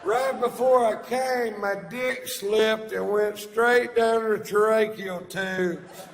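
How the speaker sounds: loud, high, shouted joke delivery; old stage bit. Acted. Comic dusting off his vile old bit for the yacht crowd, high and shouted. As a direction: belt out the vile old bit full force. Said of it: "dust her off and take her for a spin".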